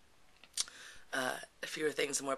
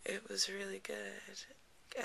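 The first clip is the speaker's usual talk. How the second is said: dazed, soft; 5 semitones higher, 9 dB quieter, much slower than usual. Retold or imitated. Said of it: "I was like".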